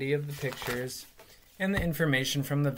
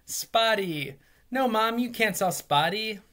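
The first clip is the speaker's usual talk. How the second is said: mild imitated wail; 7 semitones higher than usual. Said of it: "Now he started crying".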